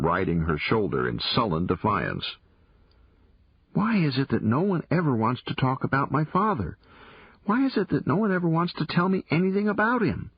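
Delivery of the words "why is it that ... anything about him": put-on higher girlish voice, rising exasperation; a girl venting that nobody tells her anything; frustrated young girl. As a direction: plead it, building frustration. Girl frustrated about father.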